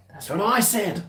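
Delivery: high-pitched mock indignation; retold exasperation. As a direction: act out a high "told you so". Retells exasperated reply to her.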